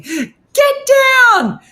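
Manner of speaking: high, full-voiced scream at the dog; loud, very high-pitched yelled command. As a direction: scream the commands at the top of your voice. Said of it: "I'm screaming".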